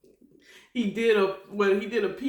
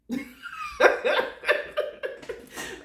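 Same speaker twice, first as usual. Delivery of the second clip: a long, high, airy burst of laughter; high breathy laugh. Real, not acted. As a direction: laugh helplessly at the answer, higher. Laughs at God's answer.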